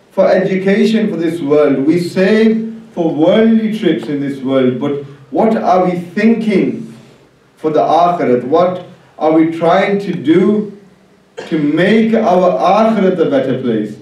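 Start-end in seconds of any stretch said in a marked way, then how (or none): none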